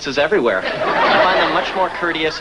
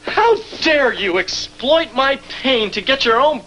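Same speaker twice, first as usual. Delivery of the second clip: mock outrage; 7 semitones higher, slower than usual. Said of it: "Keep your voice down".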